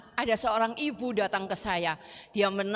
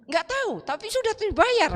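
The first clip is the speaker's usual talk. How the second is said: very high-pitched imitation of a child; acted-out eager reply, pitched way up; excited child's answer; 11 semitones higher, 4 dB louder than usual.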